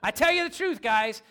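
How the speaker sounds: high, loud mock solemn promise; Jesus loudly swearing he isn't lying; hammed-up Jesus emphasis. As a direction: overplay it like a preacher swearing to it. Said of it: "I think it's funny".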